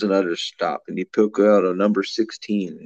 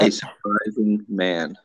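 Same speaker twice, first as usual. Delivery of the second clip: slow joke setup; slower than usual. Pretend.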